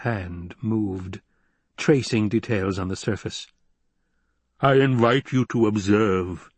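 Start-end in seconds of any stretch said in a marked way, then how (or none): 4.6-6.5 rumbling, theatrical villain baron; pompous baron's rumble; rumbling basso. A narrator's reading. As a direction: invite them grandly in a rumbling voice. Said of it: "the basso voice rumbled".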